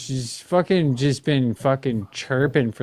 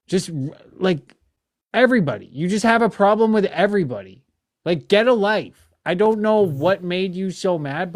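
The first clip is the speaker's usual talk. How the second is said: higher and louder, fed-up telling-off; exasperated outburst; 6 semitones higher, 4 dB louder than usual. Real, not acted. Host telling off a troll in her live, fed-up and loud. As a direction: raise the voice at everybody's critic.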